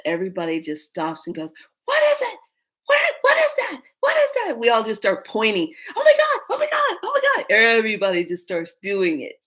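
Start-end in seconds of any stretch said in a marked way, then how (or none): 1.9-4.6 very high pretend-alarm squeal; mock panic. Everybody worldwide stops, mock-panicked squeal. Imagines everybody doing the prank. 6.0-7.5 fast, loud, high squeals; mock panic. Everybody starts pointing, mock-panicked squeal.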